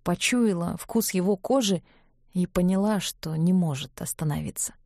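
plain reading voice

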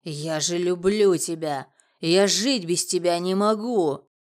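loud, drawn-out declaration of love; impassioned, slow plea; desperate pleading. A narrator's reading. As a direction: beg slowly and loudly, in anguish.

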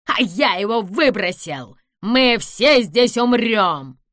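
put-on mad gloating voice; crazed villain. A narrator's reading. Villain has thrown away the key, crazed gloating. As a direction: build to a slow, loud cry of doom.

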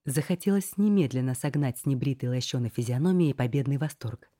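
ordinary delivery, nothing marked

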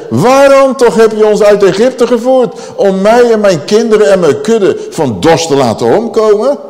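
high, loud acted outcry of complaint; grumbling protest. Thirsty people complaining to Moses, grumbling protest. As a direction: hurl the accusation as an aggrieved crowd. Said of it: "En ze morden tegen Mozes".

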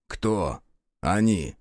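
low, sullen short questions; gloomy question. A narrator's reading. Rukh staring at mangled bodies, gloomy doubt. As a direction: ask it glumly, pitched low. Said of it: "хмуро спросил Рух".

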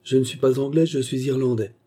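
ordinary delivery, nothing marked

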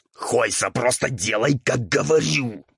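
restrained raspy growl, rising in pitch; Roul's quiet growl. A narrator's reading. Ghoul tells questioning devil to obey, growling. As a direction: growl the order with strained patience. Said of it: "перешёл на тихий рык Роуль".

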